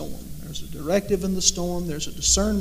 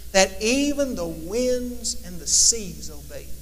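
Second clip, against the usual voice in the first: awed wonder. Acted. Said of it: "And they said".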